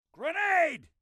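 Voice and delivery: very high, urgent combat yell; shouted warning. Acted. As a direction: yell a warning to take cover.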